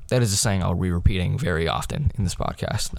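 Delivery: usual speaking voice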